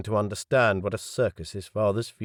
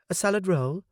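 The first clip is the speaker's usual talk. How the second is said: high, chirpy waitress voice raised well up; serving girl voice; 10 semitones higher than usual. Acted. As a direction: play the polite young waitress. Waitress offers a dish.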